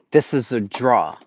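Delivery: 'draw' with no final R, Boston style; Boston pronunciation. Retold or imitated. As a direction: drop the R and land firmly on 'draw'. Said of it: "teach you how to pronounce things".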